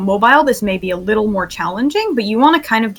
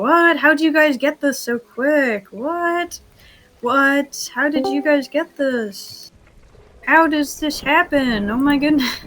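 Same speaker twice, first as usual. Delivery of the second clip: amazed disbelief; 4 semitones higher, slower than usual. Real, not acted. Amazed at trainees' speed.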